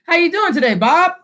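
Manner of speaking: lifted, sing-out passing hello; passing greeting. Acted. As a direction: toss a friendly greeting to someone walking by. Acts out greeting while walking past.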